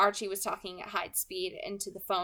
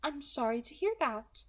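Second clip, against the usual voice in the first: light, higher, smooth villain voice; 5 semitones higher than usual. Acted. Kidnapper calmly threatening the girl on the phone, pleasant and light. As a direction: menace wrapped in a pleasant voice.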